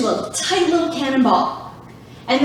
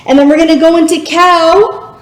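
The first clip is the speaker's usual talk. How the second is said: ordinary talk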